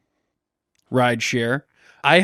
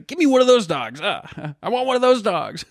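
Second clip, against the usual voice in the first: much higher, eager "give me one of those dogs"; a chatty driver spotting a dog, voice jumps up; excited driver voice; 8 semitones higher than usual. Retold or imitated. Driver spots someone walking dogs and asks eagerly in a high voice. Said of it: "He goes".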